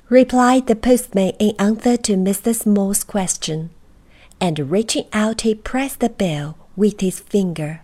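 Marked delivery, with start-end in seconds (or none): none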